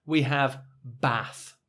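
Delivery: bath with a short flat a, slow. Shown as a demonstration. Teacher points right, says short a bath. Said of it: "on the right".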